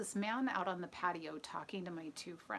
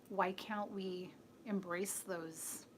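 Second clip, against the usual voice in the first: ordinary delivery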